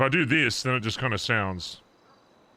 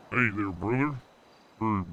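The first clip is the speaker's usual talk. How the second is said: trucker voice. Pretend. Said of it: "Is that all right?".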